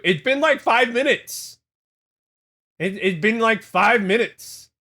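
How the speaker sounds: high, loud outraged protest; exasperated shout. Real, not acted. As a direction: cry out in mock outrage. Outraged at being called abuser.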